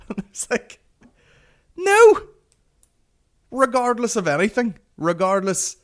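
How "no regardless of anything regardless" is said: high, loud exasperated "no"; exasperated shout. Retold or imitated. Comic retelling his answer to schoolmate, exasperated shout. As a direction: burst out loudly in disbelief.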